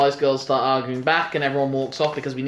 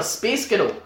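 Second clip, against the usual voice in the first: Scottish accent; 6 semitones higher than usual. Shown as a demonstration. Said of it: "what do they call the genre".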